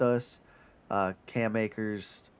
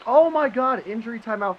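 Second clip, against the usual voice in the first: much higher, louder sudden exclamation; surprised shout; 11 semitones higher, 7 dB louder than usual. Real, not acted. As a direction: burst out in disbelief. Injury timeout helps them.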